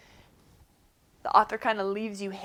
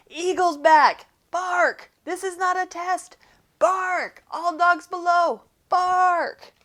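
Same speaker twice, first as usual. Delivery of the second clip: alarm call; 8 semitones higher, 5 dB louder than usual. Acted. Watchdog barking an alarm.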